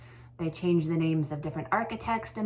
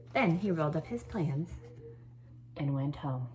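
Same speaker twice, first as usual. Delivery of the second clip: lively read-aloud, lifting the key words; storytelling voice. A narrator's reading. Teacher reading a story to her class, lively storytelling voice. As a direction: read it like a picture book to a class.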